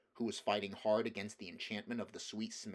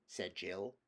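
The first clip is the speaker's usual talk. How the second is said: plain reading voice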